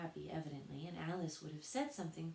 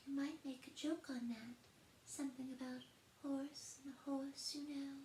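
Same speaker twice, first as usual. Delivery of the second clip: high, soft tiny gnat voice; much higher, softer tiny voice; extremely small voice; 10 semitones higher, 4 dB quieter than usual. A narrator's reading. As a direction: pipe up close to her ear. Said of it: "an extremely small voice".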